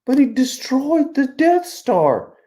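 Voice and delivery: high, drawn-out audience voice; mock-bewildered falsetto objection; Jim Gaffigan. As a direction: protest in a slow, high-pitched little voice. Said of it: "He's doing the Jim Gaffigan thing".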